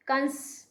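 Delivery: कंस with a clear nasal न before स; nasal demo. Shown as a demonstration. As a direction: let the न hum in कंस. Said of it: "न की ध्वनि सुनाई देगी अब आपको". (कंस में न की ध्वनि साफ़ बोलें)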